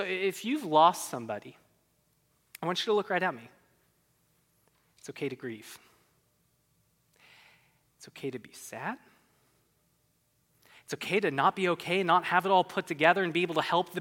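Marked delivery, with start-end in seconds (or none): 2.6-9.0 tender reassurance. Real, not acted. Pastor comforting grieving listeners.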